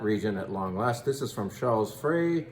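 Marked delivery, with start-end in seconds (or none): 1.9-2.5 Frey said as "fray". Shown as a demonstration. Wine host showing how to say Frey. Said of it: "You can say Fry or Frey".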